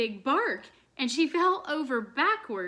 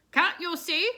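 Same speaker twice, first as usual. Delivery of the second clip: angry goose; 7 semitones higher, 6 dB louder than usual. A narrator's reading. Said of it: "angrily stomping her foot".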